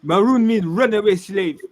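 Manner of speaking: poor imitation of African accent. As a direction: imitate an African speaker with a French tinge. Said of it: "My poor imitation of African accent".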